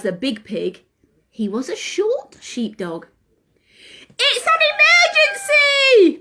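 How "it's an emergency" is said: high, loud urgent shout. Acted. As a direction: cry out the alarm, pitched high. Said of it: "cried Ozzy".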